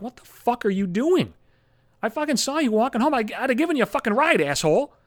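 high, exasperated scolding; mock the cousin catching him out; a fed-up roommate calling him out; annoyed cousin.

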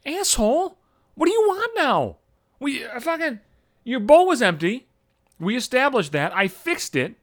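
high-pitched, acted indignant complaint; exasperated rant. Sleep-deprived owner to his meowing cat, acted exasperation. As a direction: argue with the cat in a high, fed-up voice.

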